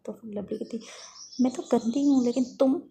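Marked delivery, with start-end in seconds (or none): 1.4-2.8 loud, acted indignant retort; offended and forceful; heated comeback. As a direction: hit back in a squabble. Firing back an insult.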